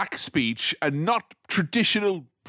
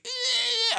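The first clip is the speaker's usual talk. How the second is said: very high mock squeal; thin imitated cry of a hedgehog in distress; dying hedgehog squeal. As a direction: squeal like a small animal dying. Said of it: "watching a hedgehog be like".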